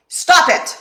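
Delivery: sudden loud high yell of Stop it; shouted stop it. Retold or imitated. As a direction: snap it out as a comic therapist's shout. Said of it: "he leans over and he screams at her".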